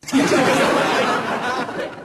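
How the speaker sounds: airy giggle; breathy high laugh. Real, not acted. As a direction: silent wheezing laugh. Laughs at own joke.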